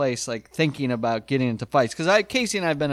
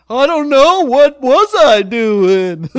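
much higher, louder, slow panicked whine; panicked squeak; 10 semitones higher, 9 dB louder, slower than usual. Retold or imitated. Pinned against the closet, answering the jock in a panicked high whine. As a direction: squeal it out helplessly.

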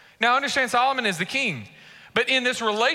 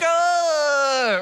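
long, higher-pitched "ah"; drawn-out comic cry of being caught looking; startled mock groan; 4 semitones higher, 3 dB louder than usual. Acts out guy caught looking.

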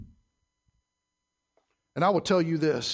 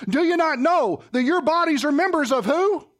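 raised voice, rising hard on who; fill-in question to the crowd; 9 semitones higher, 4 dB louder than usual. Shown as a demonstration. Preacher to congregation, leaving who hanging for them. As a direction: lift the last word who so the room answers.

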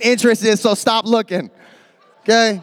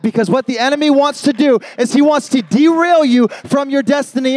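ordinary talk, nothing marked

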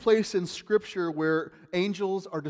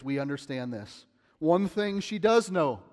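ordinary delivery

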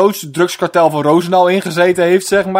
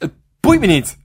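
ordinary delivery

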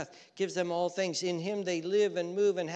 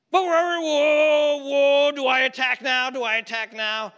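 mock alarm; 11 semitones higher, 11 dB louder than usual. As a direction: raise a hammed-up battle alarm. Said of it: "up in alarms".

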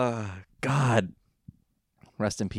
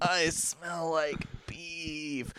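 slow, soft, drawn-out deadpan; slow Vine quote; 6 semitones higher, 8 dB quieter, much slower than usual. Retold or imitated. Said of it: "speaking in Vines".

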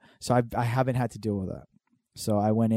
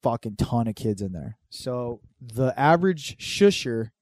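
ordinary delivery

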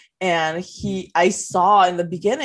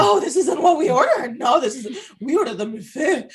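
high, laughing mock complaint; imitating picky customers at dessert; mock fussy customer; 7 semitones higher than usual. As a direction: ham up a fussy diner correcting the order. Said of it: "these people are like".

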